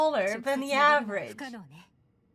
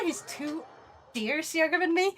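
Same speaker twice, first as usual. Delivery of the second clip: ordinary talk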